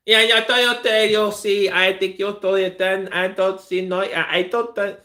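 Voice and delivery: put-on high, loud garbled Spanish-accented English; mock Spanish accent. Streamer mocking women's chopped-up English, exaggerated Spanish accent. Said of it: "sound like chopped up pieces of shit".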